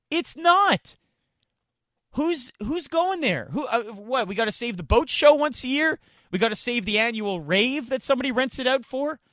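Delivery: high, scoffing questions; incredulous mockery. Real, not acted. Host mocking who would go to the stadium, incredulous. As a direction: scoff at every use of the venue.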